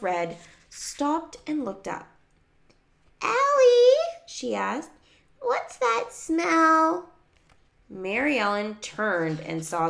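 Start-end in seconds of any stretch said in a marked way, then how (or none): 3.2-4.9 put-on very high, loud little-kid voice; high-pitched kid calling out; small child. A narrator's reading. 5.4-7.1 put-on high, slow, loud kid question; high-pitched child asking; small child. A narrator's reading. Small child voiced in story.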